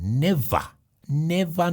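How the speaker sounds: ordinary delivery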